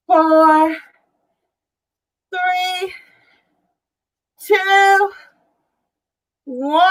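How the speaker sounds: upbeat rep countdown, count it out; aerobics instructor. Real, not acted. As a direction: call the count like a fitness coach. Counting down reps.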